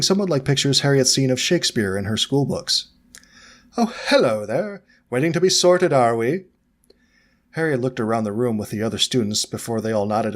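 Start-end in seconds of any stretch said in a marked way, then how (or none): 3.8-6.5 high, bright-voiced gentleman ghost, much higher; a jolly ghost gentleman greeting the children; cheerful old ghost. Ghost greets first years.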